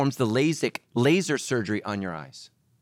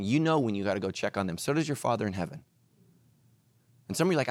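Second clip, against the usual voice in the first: normal talking voice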